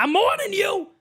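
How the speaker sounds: high, mock-threatening dad voice; father's warning. Host mimicking dad threatening him as a kid, worked-up warning. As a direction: imitate a worked-up father laying down the law. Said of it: "my father used to say".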